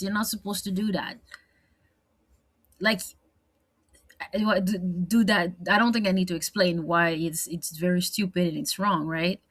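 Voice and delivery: normal talking voice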